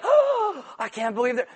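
hammed-up gasp of disbelief; mock shock. Churchgoer seeing a one-night stand walk in, mock shock.

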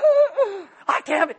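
high, lightly hammed-up disbelief; mock shock. Churchgoer seeing a familiar sinner arrive, mock shock. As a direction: imitate being taken aback.

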